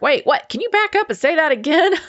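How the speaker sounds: high-pitched mock disbelief; incredulous. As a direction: imitate stunned disciples, pitch climbing. Acting out incredulous disciples.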